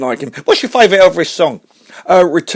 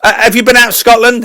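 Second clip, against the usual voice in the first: ordinary talk, nothing marked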